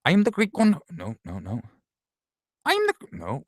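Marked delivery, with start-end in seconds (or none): 0.0-0.8 very high cartoon voice; Beavis voice. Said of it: "Beavis to do that". 2.6-2.9 very high cartoon voice; Beavis voice. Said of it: "Beavis to do that".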